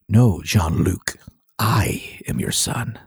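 slow, breathy parody of the Vader reveal; mock Vader reveal. Joking to cohost, slow mock Vader reveal. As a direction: drawl it like a movie villain's big reveal.

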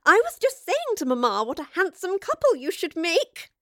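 high nasal giggle through the words; tittering. Acted. Grace teasing Jane about Rivers, high tittering voice. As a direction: titter it out, pitched well up. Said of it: "she tittered".

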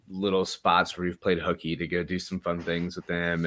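ordinary talk

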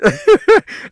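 high chuckle; amused, high-pitched giggle; real laugh. Real, not acted. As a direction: laugh at your own story. Laughing at his own story.

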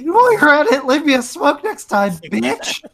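squeaky high raccoon voice; high, squeaky critter voice. Acted. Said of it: "what's the raccoon's voice?".

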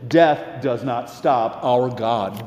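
slow, level declaration; slow steady. Acted. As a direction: state it slowly and firmly. First take, slow and level.

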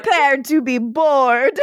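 slow put-on Eastern European accent, laughing; a mock-grand warning in an Eastern European accent; Nadja's accent. Podcaster joking as Nadja, in a slow laughing Eastern European accent. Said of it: "you did that all with the accent".

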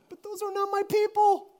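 put-on high, loud little-kid voice; five-year-old's protest. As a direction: imitate a small child objecting. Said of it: "in her own little words, I don't know, five years old".

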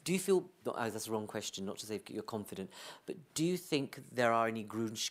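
normal talking voice

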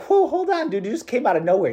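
startled Mary. Retold or imitated. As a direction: pull back half-laughing, caught off guard.

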